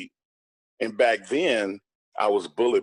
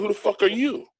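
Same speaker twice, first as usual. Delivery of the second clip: retold angry retort; 9 semitones higher than usual. Retelling his retort to Jordan, a mock angry challenge. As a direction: fire the question back hard.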